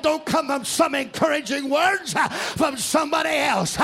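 loud, ringing gospel preaching over the organ; full-force preaching. Acted. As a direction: preach it out loud to the whole church.

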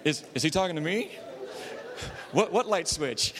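stammering, laughing, a touch nervous; mock nervous. Audience man unsure he's being spoken to, nervous. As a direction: fumble the words like you've been caught off guard. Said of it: "he got a little nervous back there going".

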